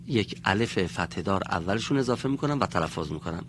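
usual speaking voice

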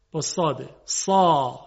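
ordinary talk

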